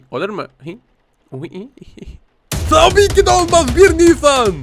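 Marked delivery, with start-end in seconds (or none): 2.7-4.5 much higher and louder, triumphant; gleeful prank reveal. Pretend. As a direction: shout out the gotcha. Second April Fools reveal. (coşkuyla, yüksek perdeden şaka ilanı)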